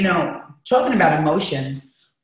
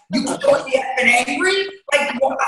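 retold angry retort; 8 semitones higher than usual.

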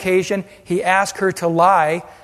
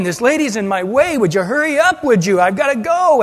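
higher, hurried angry complaint; impatient anger; 4 semitones higher than usual. Retold or imitated. David fuming at Abigail blocking his way, higher and impatient. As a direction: bark it like a man in a rush. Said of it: "David was angry".

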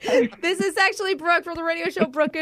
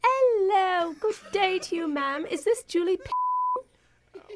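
high, hammed-up British accent; mock British phone manners; fake British accent; 5 semitones higher, slower than usual. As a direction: put on a prim British caller, pitched high. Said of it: "I'm a little surprised by your accent".

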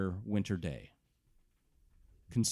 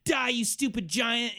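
put-on much higher, playful child's battle cry; little kid voice; 6 dB louder than usual. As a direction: squeak it like a small kid.

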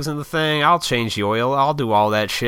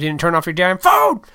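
raised-pitch burst of annoyance; exasperated self-scolding; 6 semitones higher, 3 dB louder than usual. Acted. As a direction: build to an exasperated yell on the last word. Scolding himself over ringing phone.

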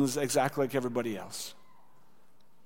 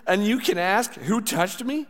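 higher-pitched questioning, baffled; incredulous disciples; 6 semitones higher than usual. A narrator's reading. Disciples throwing Jesus's question back at him, incredulous. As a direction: echo the question in disbelief.

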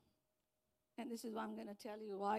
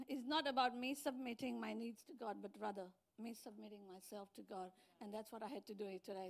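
ordinary talk

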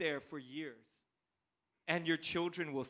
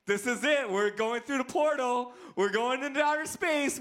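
higher, louder, building thrill; excited kid; 7 semitones higher, 4 dB louder than usual. Retold or imitated. Retelling his childhood ride to space, excited. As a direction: relive a kid's excitement at blastoff.

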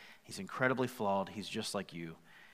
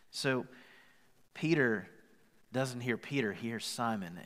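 ordinary talk, nothing marked

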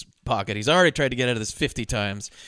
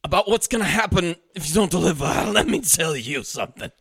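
slow, higher, relishing menace; salivating menace; 4 semitones higher than usual. Retold or imitated. Host quoting Walken threatening the patsy, slow relish. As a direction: savor every word of the threat, slowly. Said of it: "he's practically salivating".

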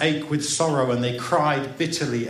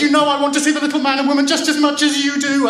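put-on high, loud, rushed childish wail; acted quarrelling child's outcry; crying out; 9 dB louder, faster than usual. A narrator's reading. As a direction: protest in a fast high wail. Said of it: "she cried".

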